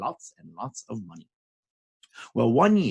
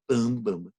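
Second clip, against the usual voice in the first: ordinary delivery, nothing marked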